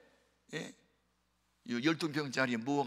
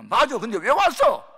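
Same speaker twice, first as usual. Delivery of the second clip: loud, much higher puzzled reply; 10 semitones higher, 13 dB louder than usual. Retold or imitated. Mentor baffled why Choi came, loud and puzzled. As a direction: blurt it out, baffled.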